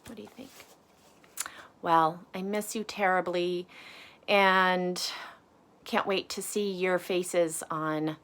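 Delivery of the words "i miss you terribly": usual speaking voice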